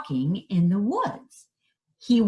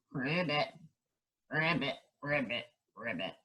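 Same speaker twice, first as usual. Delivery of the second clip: low, soft ribbits getting softer; frog croak; 5 semitones lower, 11 dB quieter, faster than usual. Acted. Frog prince croaking alone after the curse, low and fading. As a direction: croak like a sad little frog.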